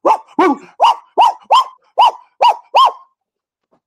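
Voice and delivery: very high, loud squealing woo; mock yapping bark. Mocking a barking politician with high yelps. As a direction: yelp it like a tiny frantic dog. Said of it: "he barks so loud".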